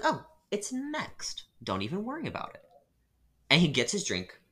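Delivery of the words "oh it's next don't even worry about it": sweetly put-on barista reassurance; customer service voice. Barista soothing a pushy customer, mock-sweet and high.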